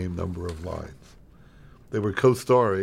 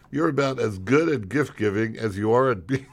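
cracking up while reading the insult; barely holding in a laugh; laughing mid-line. Real, not acted.

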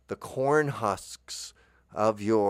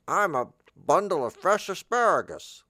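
high silly vegetable voice; asparagus voice; 8 semitones higher, 4 dB louder than usual. Pretend. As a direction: squeak it out like a talking bunch of asparagus. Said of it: "and say".

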